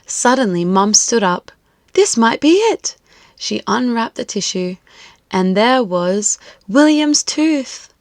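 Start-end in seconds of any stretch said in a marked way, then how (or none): 1.9-3.0 high, eager discovery; hopeful excitement. Acted. Mum searching the bin, excited. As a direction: say it like you just found treasure.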